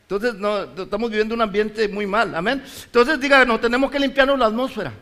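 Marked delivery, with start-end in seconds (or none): 2.8-4.9 preaching call. Acted. Preacher calls congregation to repeat.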